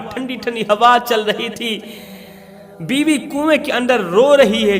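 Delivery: ordinary delivery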